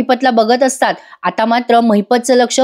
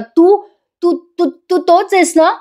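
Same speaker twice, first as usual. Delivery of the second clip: higher, halting, stammering in fear; frightened stammer; 7 semitones higher, slower than usual. Acted. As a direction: stutter out the question as if seeing a ghost. Pratima terrified, recognising attacker. (घाबरलेली, अडखळत विचारणारी आत्या)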